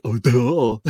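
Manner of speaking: "Oh, duh" dissolving into a high real laugh; sheepish self-mocking laugh; laughing at himself. Real, not acted. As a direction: laugh off your own blunder. Realizing the obvious PA solution.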